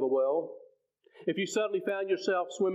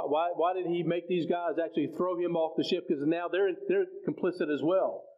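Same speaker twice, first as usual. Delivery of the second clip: usual speaking voice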